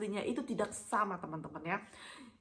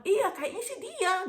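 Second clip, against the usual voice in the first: high, drawn-out imitation of the driver; excited insistence; 11 semitones higher, slower than usual. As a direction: insist in a slow, high-pitched voice. (tiruan sopir ngotot, nada tinggi)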